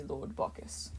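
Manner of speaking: a god mimicking a frightened worshipper's plea; terrified whisper. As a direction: whisper it like a cowering mortal.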